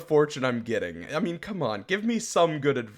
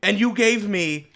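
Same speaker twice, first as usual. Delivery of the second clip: higher and louder, indignant; mock offended rant; 4 semitones higher, 7 dB louder than usual. Real, not acted. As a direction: rant about being insulted. Offended by cake fortune.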